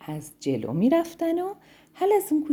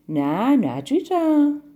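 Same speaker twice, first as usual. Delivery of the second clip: slow snake voice; 5 semitones higher, 5 dB louder, much slower than usual. Acted. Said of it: "پیچ و تابی خورد و گفت".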